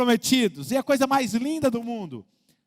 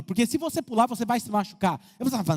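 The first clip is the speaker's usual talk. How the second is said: ordinary talk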